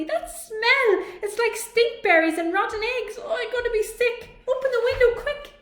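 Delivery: high, loud and gagging; panicked robot shouting over skunk smell; disgusted shouting. A narrator's reading. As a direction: shout in disgust about the stink.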